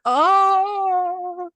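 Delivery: high wailing oh of a crying grandmother. Retold or imitated. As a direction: cry out the oh like a sobbing old woman. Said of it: "My grandmother starts crying".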